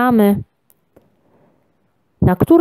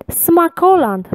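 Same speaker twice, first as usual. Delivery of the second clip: much higher, straining to read it out; effortful reading; 10 semitones higher, 4 dB louder, slower than usual. A narrator's reading. Traveller finally reads the restaurant sign, high and effortful. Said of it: "przeczytał w końcu z wysiłkiem".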